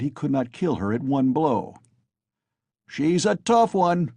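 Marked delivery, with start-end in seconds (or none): 2.9-4.1 shouted remark. A narrator's reading. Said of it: "was shouted in the crowd".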